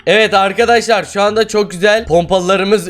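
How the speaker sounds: high, lively address to viewers; excited announcement. Real, not acted. Player starting an update for viewers, excited. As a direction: kick off the update brightly.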